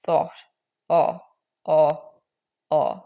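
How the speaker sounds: "aw" held open, between oo and aw; drawn-out Middle English aw vowel. Shown as a demonstration. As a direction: say "aw" as a pure open vowel, not oo. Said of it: "it's not quite an oo or an aw".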